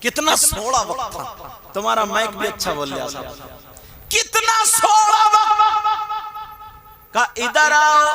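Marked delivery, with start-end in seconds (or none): 4.1-8.0 loud, chanted sermon storytelling; preaching at full force. Acted. Preacher to the congregation, telling the Prophet's story, fervent chanting preaching. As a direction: preach it with swelling fervour, half sung.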